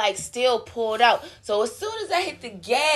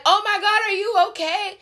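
higher, worried oh my God; alarmed bystander; 9 semitones higher than usual. Retold or imitated. Passerby asks fallen cyclist if she's okay, alarmed. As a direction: imitate a passerby rushing to help. Bystanders quoted after the crash.

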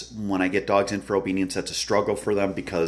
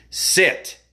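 loud, higher-pitched booming command; 11 semitones higher, 5 dB louder than usual. Shown as a demonstration. Trainer stunning a dog that didn't sit, loud booming command. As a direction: deliver it as a verbal stun. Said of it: "giving them that loud, booming voice".

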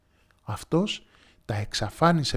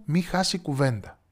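plain reading voice

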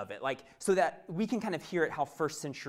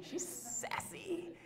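sassy; 13 semitones higher, 10 dB quieter, much slower than usual. Real, not acted. Sassy toddler aside.

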